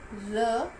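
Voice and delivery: isolated sound र, said slowly and clearly on its own; teacher sounding out र; letter sound demo. Shown as a demonstration. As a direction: sound out र as a single consonant. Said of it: "रियल की sound होती है".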